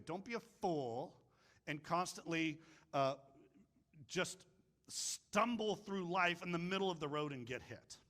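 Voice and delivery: ordinary delivery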